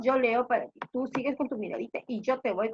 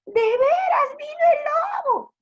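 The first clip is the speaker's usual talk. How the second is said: very high, loud, sustained plea; desperate shout; 8 dB louder than usual. Acted. Shepherd begs villagers to believe him, desperate shouting. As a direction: scream it to be believed. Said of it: "gritaba él con desesperación".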